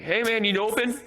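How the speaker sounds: high, tentative mock call. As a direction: imitate yourself calling to a shopkeeper. Calling to man through broken glass.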